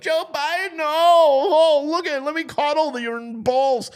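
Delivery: high, loud mock gushing; fawning Democrats. Democrats fawning over Joe Biden, high mock gushing. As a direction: swoon over him in a high mocking voice. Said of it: "all these Democrats that are out here like".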